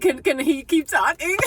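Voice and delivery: high, loud, giddy retelling; imitating a gushing, delighted request; eager plea. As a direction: beg excitedly, about to laugh. Retells her eager request about accent.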